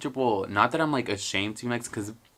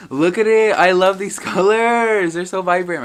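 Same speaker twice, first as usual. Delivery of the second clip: high, loud delight over the flag; thrilled show-off; excited gushing; 8 semitones higher, 11 dB louder than usual. Real, not acted. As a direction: gush about the colors, laughing. Showing off her new flag.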